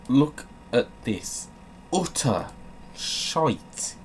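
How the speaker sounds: usual speaking voice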